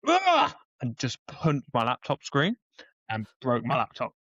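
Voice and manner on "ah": sudden high, loud yell of frustration; reaction yell. Retold or imitated. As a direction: re-enact an exasperated outburst. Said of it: "reacted, went".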